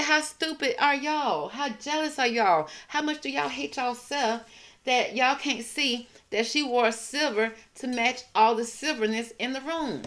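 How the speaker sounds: high, slow scornful taunt; snapping quote. Retold or imitated. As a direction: drag them slowly, high and mocking. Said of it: "Miss Tina snapping on y'all".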